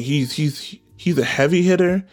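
ordinary talk

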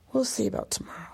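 soft unsure whisper; whispered doubt. Real, not acted. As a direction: whisper it to yourself. Doubtful aside.

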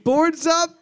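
loud high call. Acted. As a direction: shout it to the room. Quiz call.

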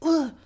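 grossed-out groan; a disgusted ugh, pulled back; annoyed ugh. Real, not acted. Disgust at the scoop mark.

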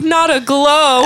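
high, loud knowing retort, drawn out; wry retort. Retold or imitated. Retelling Roz to Daphne, a high loud knowing retort.

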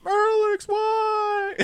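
very high, faraway wailing call; a distant wounded voice; ghostly plea. Retold or imitated. Sister calling out from the painting, very high and pained. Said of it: "you hear, like".

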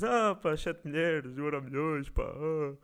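mocking tone. Shown as a demonstration. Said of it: "num tom de gozo dizeres".

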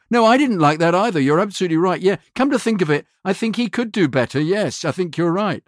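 high-pitched mock yes-man; over-eager agreement. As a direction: fall over yourself to agree. Acted clients backtracking to agree.

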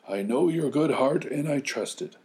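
a hint of a high old grandfather; gentle trust; lightly voiced old man. Grandfather trusting the girl, lightly voiced.

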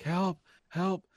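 high, playful plea for help; mock cry for help. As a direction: pretend to call out for help. Acts out patient calling for help.

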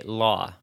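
law with a rounded aw, like lawh; Jersey law. Shown as a demonstration. Showing the other word, law with a Jersey aw. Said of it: "If I'm saying the other word".

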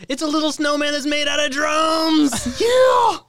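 high, loud slow shout; yelling. Shown as a demonstration. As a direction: shout the item proudly for the game. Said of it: "Yell it".

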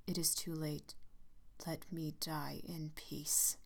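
a slow breathless whisper; dying man pleading to be left in peace. A narrator's reading. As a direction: fade out, barely voiced.

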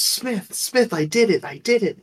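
high, giddy imitation of a happy coworker; a coworker bursting in to report, imitated with excited pitch; excited retelling. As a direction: bounce it up like a proud kid. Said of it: "all happy, excited".

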